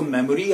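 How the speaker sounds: मेमोरी in three syllables, me-mo-ree; wrong pronunciation. Shown as a demonstration. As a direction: sound the middle o in मेमोरी. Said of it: "आगे से मत बोलिएगा". (मेमोरी का गलत उच्चारण, मे-मो-री)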